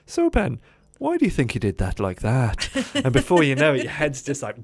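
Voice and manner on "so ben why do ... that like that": put-on Reg's Irish accent. Irish trainer quizzing Ben after a task, in a cheeky Irish accent. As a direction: probe like a playful Irish coach in an Irish accent. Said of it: "he'd come up in this little Irish accent".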